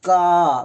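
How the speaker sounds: का called out loud with a long held vowel; का stretched out to show the आ inside; drawn-out का. Shown as a demonstration. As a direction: hold the vowel in का, loudly. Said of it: "इसको बोलो तो".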